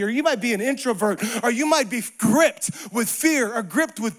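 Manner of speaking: loud, high, driving sermon cadence; full-force preaching. Acted. Preacher urging believers that God is with them, preaching at full force. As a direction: preach it to the back row.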